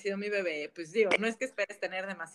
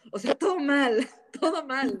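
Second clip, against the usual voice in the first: higher, louder, through real laughter; laughing confession; 6 semitones higher, 6 dB louder than usual. Real, not acted. New mother admitting she left the baby naked, laughing confession. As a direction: laugh helplessly at your own blunder.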